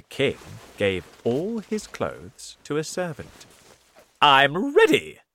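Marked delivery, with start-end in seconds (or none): 4.2-5.2 happy. Acted. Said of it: "he said happily".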